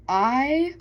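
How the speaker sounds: words stretched by a yawn. Real, not acted. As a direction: talk through a real yawn. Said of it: "sorry, I'm yawning".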